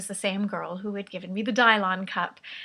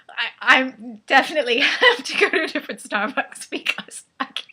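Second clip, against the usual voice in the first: higher, loud, breaking into laughter; laughing exasperation; 4 semitones higher, 8 dB louder than usual. Real, not acted. Customer swearing off that Starbucks, laughing in exasperation. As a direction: stammer it out through real laughter. Laughing over the botched name.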